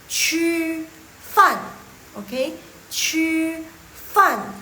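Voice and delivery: "Chu" held high and level, "fan" dropped sharply; teacher's tone drill on "Chu fan"; model pronunciation. Shown as a demonstration. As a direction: say "Chu fan" slowly, loud and separated for students to copy. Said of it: "Jadi yang benernya coba ikutin".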